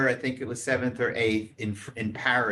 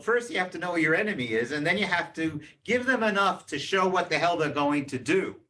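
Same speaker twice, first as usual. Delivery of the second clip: ordinary talk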